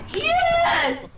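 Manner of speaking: very high, gleeful yell; excited yell. Retold or imitated. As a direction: shriek it like you just won. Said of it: "and he's like".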